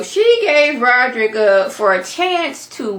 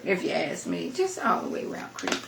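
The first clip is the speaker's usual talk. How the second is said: softer, unvoiced whisper; whispered aside; 11 dB quieter than usual. Real, not acted. Vlogger judging a creepy character, whispered aside. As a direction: let it slip as a conspiratorial whisper.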